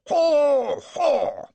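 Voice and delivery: high, loud aristocratic braying haw haw; affected haw haw. A narrator's reading. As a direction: bray a lordly greeting. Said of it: "I heard his".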